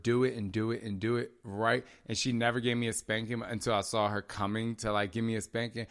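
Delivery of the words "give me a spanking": normal talking voice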